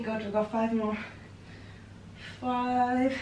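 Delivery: ordinary talk, nothing marked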